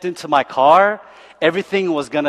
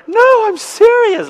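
much higher, slow and louder insistence; insistent driver; 7 dB louder, slower than usual. Retold or imitated. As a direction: protest like the gushing driver. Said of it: "He's like".

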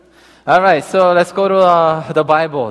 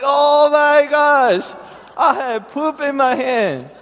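very high, drawn-out mock wail; slow, high cry of comic dismay; milk the disgust, stretching it out high; hammed-up horror; 11 semitones higher, slower than usual. Retold horror at poop in hand.

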